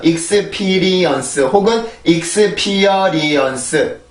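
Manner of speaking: slow "익스피리언스", then longer "익스피어리언스"; Korean syllable-by-syllable reading. Shown as a demonstration. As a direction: sound out "익스피어리언스" in seven even syllables. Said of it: "칠음절이에요". (음절마다 또박또박 "익스피어리언스")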